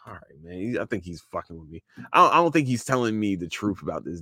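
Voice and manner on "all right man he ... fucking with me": skeptical aside. Retold or imitated. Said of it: "And you just like".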